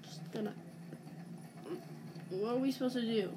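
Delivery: ordinary talk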